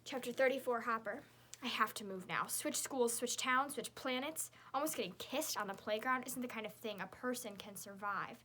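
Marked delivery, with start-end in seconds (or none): none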